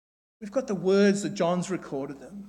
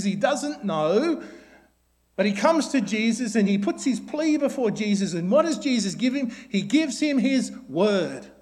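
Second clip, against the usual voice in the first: normal talking voice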